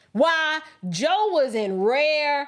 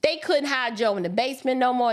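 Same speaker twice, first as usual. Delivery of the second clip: ordinary delivery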